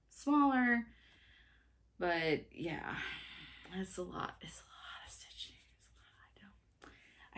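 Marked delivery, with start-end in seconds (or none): 3.8-7.4 a whisper that fades almost to nothing; fading whisper. Real, not acted. Stitcher showing viewers how big the pattern is, fading to a whisper. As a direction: let it die away to a breath, overwhelmed. Overwhelmed by size of project.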